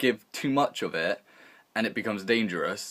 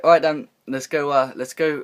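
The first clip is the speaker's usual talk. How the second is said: eager; 4 semitones higher, 7 dB louder than usual. Retold or imitated. Eager kids rush to open presents.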